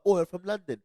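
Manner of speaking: put-on high, loud British accent boast. Retelling his school prank on friends, put-on British accent. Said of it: "I had the accent".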